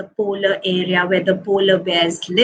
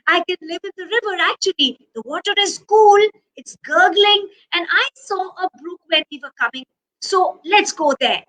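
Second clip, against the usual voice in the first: quick, chattering and pitched up; eager polar bear; 7 semitones higher, faster than usual. Acted. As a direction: rattle it off with excitement. Polar bear excited about river.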